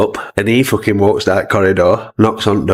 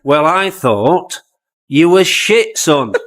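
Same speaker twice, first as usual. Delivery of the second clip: mock put-down; 7 semitones higher, much slower than usual. Clough's put-down.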